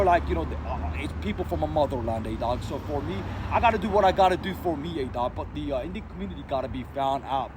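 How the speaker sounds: ordinary delivery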